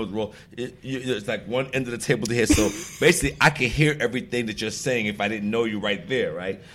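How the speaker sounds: ordinary delivery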